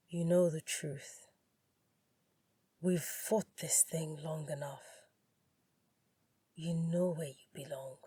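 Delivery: soft, low, insistent ex-lover; hushed appeal to come back. A narrator's reading. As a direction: press her quietly, with certainty. Ex-lover calls her back.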